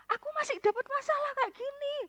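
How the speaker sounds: lightly voiced whiny friend, pitched high; a hint of a friend whining about her problems; complaining friend. Preacher imitating her complaining friend, mildly whiny.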